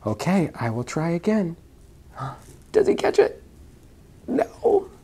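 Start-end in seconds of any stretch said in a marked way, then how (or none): 2.7-4.9 very high squeaky voice. Acted. Mother voicing high suspense to her son.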